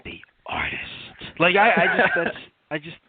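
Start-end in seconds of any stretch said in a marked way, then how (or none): 0.0-1.4 low, slow, raspy Vince impression, lightly done; crackhead Vince impression. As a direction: drag out the name like a worn-out boss. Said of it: "that's a very good Vince".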